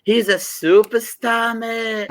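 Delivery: mock a Liverpool supporter bigging up Gerrard in Scouse; exaggerated Liverpool accent with drawn-out mate; Scouser accent. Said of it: "can you do a Scouser accent".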